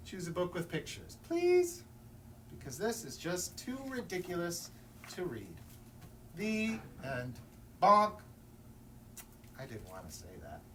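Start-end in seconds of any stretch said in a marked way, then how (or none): 1.3-1.8 a high, loud squeal of please; one last begging squeal; desperate plea. Acted. Storyteller begging the reader, squealing please. 7.8-8.1 loud mock bonk; bonk sound. Storyteller ending the book with a loud bonk.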